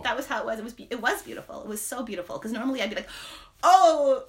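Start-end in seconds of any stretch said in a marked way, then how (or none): 3.6-4.2 a sudden loud scolding gasp; harsh self voice. Retold or imitated. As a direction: blurt it out as your own harsh critic. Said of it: "like a self voice".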